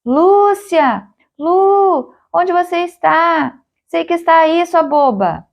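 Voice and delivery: high-pitched calling out for someone lost; shouted call, pitched well up; shouting. A narrator's reading. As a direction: call out high, searching for her. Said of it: "Chegou a gritar".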